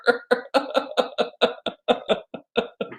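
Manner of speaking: high, breathy laughing fit; long helpless run of laughter; real laughter. Real, not acted. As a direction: laugh hard at your own joke. Laughs at own story.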